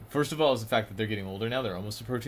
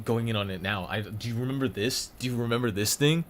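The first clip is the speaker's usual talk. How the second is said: ordinary talk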